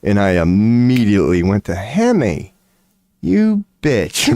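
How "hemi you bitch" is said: high put-on voice calling the cat a bitch; high mock voice naming the cat; mock curse. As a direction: squeak it out with fond mockery.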